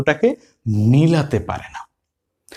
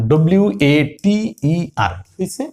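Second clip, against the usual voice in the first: usual speaking voice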